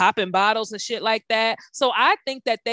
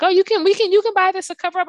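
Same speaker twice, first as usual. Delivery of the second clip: higher, louder sing-song mock of the hostess; 10 semitones higher, 6 dB louder, faster than usual. Mocking the hostess who offers a shirt, high and loud. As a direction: parody her sugary helpful offer.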